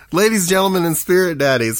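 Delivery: loud showman call; announcer shout. Pretend. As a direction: shout it to the crowd. Shouted mock intro.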